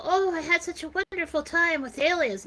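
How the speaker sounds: put-on blissful memory; fanciful gushing. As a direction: gush as if recalling a lovely time, mockingly. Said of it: "they give you some fanciful".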